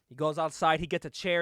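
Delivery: usual speaking voice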